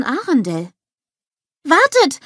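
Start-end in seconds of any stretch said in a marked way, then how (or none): 1.6-2.2 high, loud urgent call. A narrator's reading. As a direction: shout it to stop people leaving.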